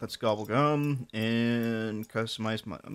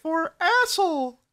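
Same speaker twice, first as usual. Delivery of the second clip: high Muppet announcer voice; 3 dB louder than usual. Acted. Sesame Street announcer parody.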